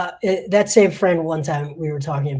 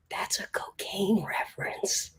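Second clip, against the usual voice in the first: whisper; 5 semitones higher, 6 dB quieter, slower than usual. Retold or imitated. Reporter leaning in to whisper.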